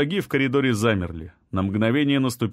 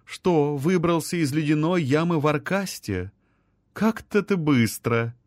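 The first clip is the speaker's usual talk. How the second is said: higher, lilting, teasing queen; musical-voiced queen; 5 semitones higher than usual. A narrator's reading. Queen teases Руадан about escaping the pit, musical voice. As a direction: tease lightly in a singing voice. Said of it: "пропел музыкальный голос".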